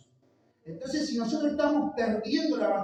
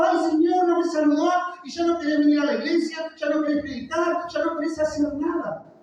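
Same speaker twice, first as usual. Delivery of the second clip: mock whining, higher and louder; mocking whiny complaint; 7 semitones higher, 3 dB louder than usual. Preacher mocking members hurt by a missed greeting, whiny. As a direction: whine it like a wounded believer.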